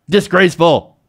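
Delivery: lightly acted indignant Trump exclamation; lightly mocking Trump's outrage; Trump quote. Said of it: "a recent tweet from Trump, he said".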